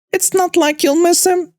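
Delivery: high, mocking sneer. Mother sneering at the manager about the worker.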